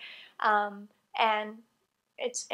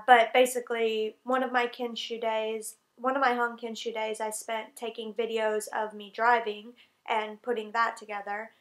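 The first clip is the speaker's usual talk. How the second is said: ordinary talk, nothing marked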